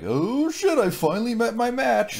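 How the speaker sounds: squeaky high woman's voice; high mock woman.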